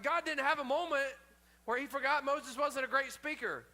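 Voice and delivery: ordinary talk, nothing marked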